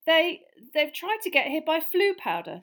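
high, loud, hesitant start; flustered young explainer. Acted. Harry explaining to angry uncle, flustered. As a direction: stammer into a hurried explanation. Boy explains, fighting laughter.

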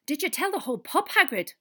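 loud, high-pitched scolding; exasperated. A narrator's reading. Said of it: "said Professor McGonagall exasperatedly".

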